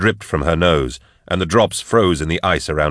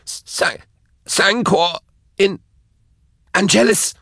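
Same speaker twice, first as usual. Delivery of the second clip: high stammering answer; broken, very high captive voice; spluttered; slower than usual. A narrator's reading. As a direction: stutter the name out, word by word. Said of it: "she spluttered".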